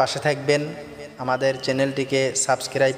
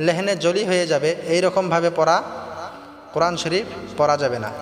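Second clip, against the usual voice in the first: ordinary delivery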